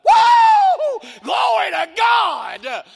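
high whooping holler, "God" stretched long; ecstatic shout. Retold or imitated. Man overcome by revelation in his truck, ecstatic shouting. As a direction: erupt in a full-throated praise shout. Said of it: "I'm shouting in my truck".

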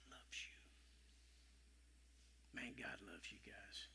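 low tender whisper. Real, not acted. Preacher assuring the congregation, tender whisper. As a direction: hush the room and breathe it.

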